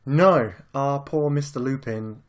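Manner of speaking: normal talking voice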